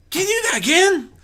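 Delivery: high, loud, fast eager filly voice. Acted. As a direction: bounce with excitement. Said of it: "Skulu asked eagerly".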